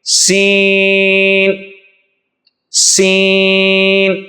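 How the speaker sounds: سین drawn out very slowly, with a hiss; سین held extra long, whistling s; long seen. Shown as a demonstration. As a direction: stretch سین long and sharp. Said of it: "لمبا کر کے پڑھیں گے".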